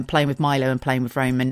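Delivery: usual speaking voice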